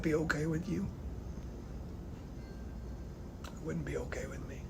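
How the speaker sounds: soft, choked-up pleading, mostly quiet with whispered lines; holding back tears while pleading; getting emotional. Real, not acted. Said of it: "Now I'm gonna get emotional".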